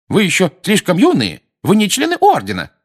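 thin, high, loud scolding voice; mocking mother impression. A narrator's reading. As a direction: mimic a scolding mother in a piping voice. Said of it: "Фред тонким голосом, страшно похожим на материнский". (тонким материнским голосом)

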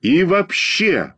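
high, stretched-out exclamation; shouting to class. A narrator's reading. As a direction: declare it slowly to the whole room. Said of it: "продолжал кричать на весь класс".

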